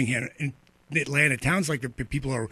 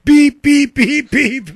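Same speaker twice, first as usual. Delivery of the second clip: loud, high mock censor bleeps; censor beep; 13 semitones higher, 13 dB louder, much slower than usual. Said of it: "And how do I really feel about it?".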